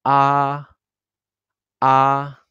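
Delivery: high, level, sustained "Ah"; tone one. Shown as a demonstration. Teacher reviews tone one for learners, high flat "Ah". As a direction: hold "Ah" on one high pitch. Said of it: "very high volume and flight".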